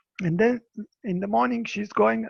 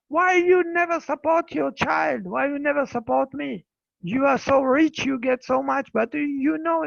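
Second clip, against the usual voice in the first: retold accusing shout; 5 semitones higher, 5 dB louder than usual. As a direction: blame him in front of everyone. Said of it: "starting to shout to the Buddha".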